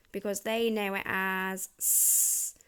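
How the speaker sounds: ordinary delivery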